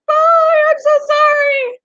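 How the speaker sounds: high, loud sorrowful farewell; mock tearful goodbye. Saying goodbye to a mouse taken for feeding, high and loud. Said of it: "I'm just like".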